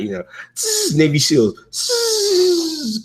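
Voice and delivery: high hissed tss sound effects; playful imitation of silenced shots; mock gunfire hiss. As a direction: spit out little silenced-gun noises. Mimicking commando gunfire sounds.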